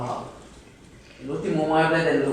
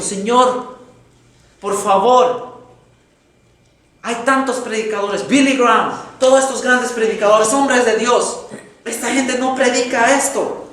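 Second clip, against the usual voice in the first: mild tearful plea; 5 semitones higher, 3 dB louder, slower than usual. Retold or imitated. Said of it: "él empezó a llorar".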